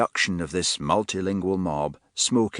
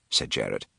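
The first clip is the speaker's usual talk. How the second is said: ordinary delivery, nothing marked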